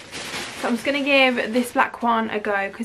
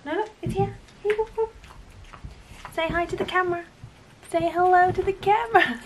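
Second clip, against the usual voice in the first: dog voice; 8 semitones higher than usual. Real, not acted. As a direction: coax the puppy with a high cooing voice. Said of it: "Excuse my dog voice as well".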